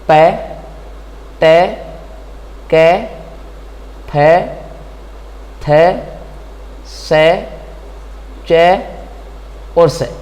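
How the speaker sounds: each ending sound said alone, slowly, with pauses; teacher sounds out the ending sounds clearly; sounds read out one by one. Shown as a demonstration. Teacher to students, listing ending sounds one by one. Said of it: "sound आपका".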